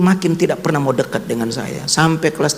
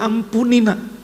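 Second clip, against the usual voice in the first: high, pleading voice begging forgiveness; tearful pleading; 10 semitones higher than usual. Retold or imitated. Father kneeling, begging his son's forgiveness, tearful plea. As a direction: plead with your son, close to tears. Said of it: "Saya bilang".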